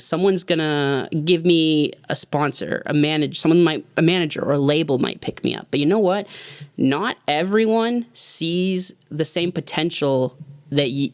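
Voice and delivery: ordinary delivery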